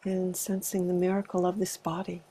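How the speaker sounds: calm, unhurried meditation guidance with long pauses; spacious meditation leader's delivery; guided meditation. Acted. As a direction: gently guide a group into arriving, leaving space between phrases. Leads a body meditation.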